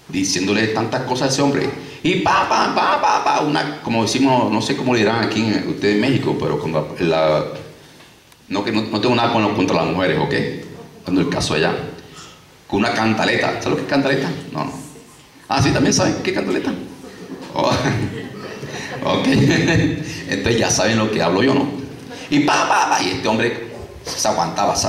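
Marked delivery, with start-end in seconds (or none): 2.0-3.4 mock nagging chatter. Imita la cantaleta de la esposa. 22.3-22.9 mock nagging chatter. Imita la cantaleta de la esposa.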